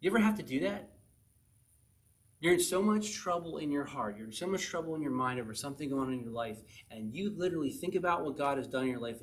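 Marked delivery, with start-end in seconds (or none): none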